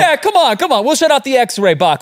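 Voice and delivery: playful guard voice. Pretend. Playing TSA agents teasingly.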